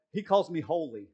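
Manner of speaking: normal talking voice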